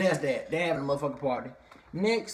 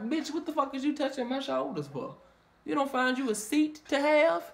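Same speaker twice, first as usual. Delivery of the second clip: annoyed attitude; 9 semitones higher than usual. Retold or imitated. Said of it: "she was looking at her like".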